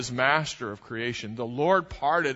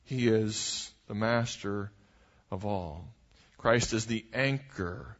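solemn preaching; 3 semitones lower than usual. Acted. Slow solemn preaching close.

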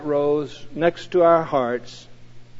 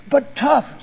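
higher, eager burst; excited; 9 semitones higher than usual. A narrator's reading. The Lord defends his mother model, excited. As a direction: snap back with pride. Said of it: "said the Lord excitedly".

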